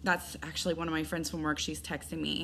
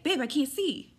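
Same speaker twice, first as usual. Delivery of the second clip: higher, louder call for help; imitated alarm; 9 semitones higher, 5 dB louder than usual. Boyfriend calls to her from the family room, imitated alarm. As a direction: call out as your alarmed partner, lightly acted.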